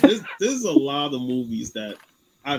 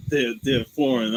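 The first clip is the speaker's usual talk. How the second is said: ordinary talk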